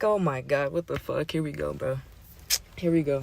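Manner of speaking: low, subdued resigned thought; inner voice. Retold or imitated. Narrator thinking as trouble starts, quietly resigned. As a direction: say it to yourself, sinking. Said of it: "In my head".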